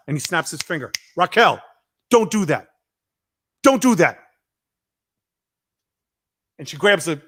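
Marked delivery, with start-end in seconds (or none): 1.2-4.2 louder and higher warning; mock stern rebuke to a star; scolding. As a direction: tell her off, building. John scolding Raquel.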